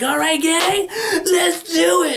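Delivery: high, loud cheery call with a laugh, slowly drawn out; rally the gang like a cheesy nineties host; hammed-up workout host. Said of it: "And they're like".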